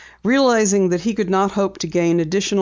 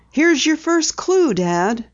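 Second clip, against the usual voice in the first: much higher, eager announcement; excited; 9 semitones higher, slower than usual. A narrator's reading. Daughter spots hoof marks for her father, excited. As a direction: call it out with excitement.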